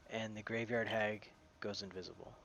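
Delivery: ordinary talk, nothing marked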